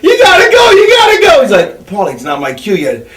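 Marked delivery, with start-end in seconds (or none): 0.0-1.4 squealing high, loud panic; freaking out. Retold or imitated. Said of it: "Paulie's freaking out". 1.9-3.1 low, quiet, unbothered; doctor calmly holding off Paulie, low; calm and cool. Retold or imitated. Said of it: "completely cool under pressure".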